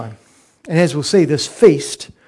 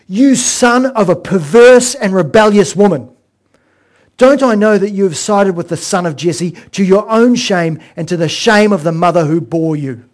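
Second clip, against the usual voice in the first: fury; 3 semitones higher, 7 dB louder than usual. Retold or imitated. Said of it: "Let's hear his fury again".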